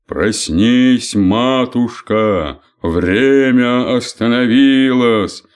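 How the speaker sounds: loud, slow, booming into her ear; trumpeting. Acted. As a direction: boom it out slowly. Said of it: "затрубил ей на ухо Век". (громко трубить, медленно)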